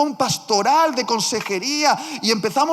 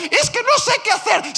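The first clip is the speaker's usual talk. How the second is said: high, loud, fast frantic cry; panicked shout; 13 semitones higher, 5 dB louder than usual. Retold or imitated. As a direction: shout like a man about to lose everything. El hombre desesperado. (grito desesperado, agudo, fuerte y rápido)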